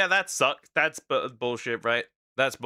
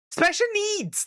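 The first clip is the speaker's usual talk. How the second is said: sudden high jab. Real, not acted. Hesitant jab, pitch jump.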